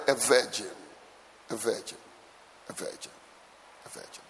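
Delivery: VIRGIN repeated low, fading to a whisper; soft, low echoes of VIRGIN dying away; hushed emphasis. Real, not acted. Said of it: "Can I emphasize that word".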